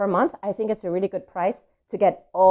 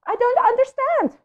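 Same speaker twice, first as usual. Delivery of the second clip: very high, loud, whining complaint; whiny pigeon; 8 dB louder than usual. Acted. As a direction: squeal it in frustration. Pigeon's upset outburst.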